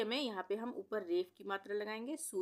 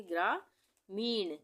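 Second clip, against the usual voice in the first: very slow; much slower than usual. Shown as a demonstration. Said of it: "teacher models word".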